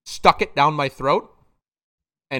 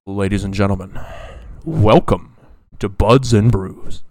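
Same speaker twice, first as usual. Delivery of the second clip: MC host voice; 5 semitones lower, 9 dB louder than usual. Shown as a demonstration. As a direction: boom it out like a show host. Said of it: "if I try".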